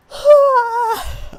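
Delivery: long, high groaning sigh; worn-out sigh before saying she's tired; loud weary sigh. Real, not acted. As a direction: let out a big exhausted sigh. Tired after no sleep.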